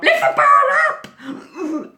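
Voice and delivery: horrified shout. Retold or imitated. Terrified skier screaming back at the boy, horrified shout. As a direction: shriek it in terror.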